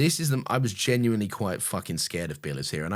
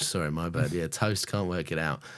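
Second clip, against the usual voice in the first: normal talking voice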